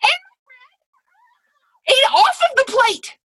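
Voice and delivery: high, loud squealing outrage; comic meltdown. Real, not acted. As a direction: lose the words in a climbing, wailing rant. Comic meltdown over homemade dinner.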